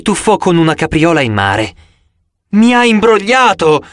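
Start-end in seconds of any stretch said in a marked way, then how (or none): 2.5-3.8 higher, louder cry of being cheated; a touch of a shriek, higher and louder. Acted. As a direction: yell it out, outraged at the trick.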